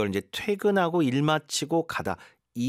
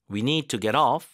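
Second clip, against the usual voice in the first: "off" louder with a rise; stress on off; 3 dB louder, much slower than usual. Shown as a demonstration. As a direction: push up on "off". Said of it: "강세를 어디다 넣어야 되는지".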